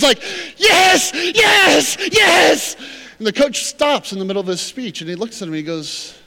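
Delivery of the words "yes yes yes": loud, high cheering yes; excited shout. Retold or imitated. Walk-on cheers mid-speech, loud excited yelling. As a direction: burst out cheering like an overeager walk-on.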